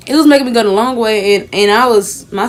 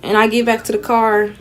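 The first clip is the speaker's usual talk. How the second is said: ordinary delivery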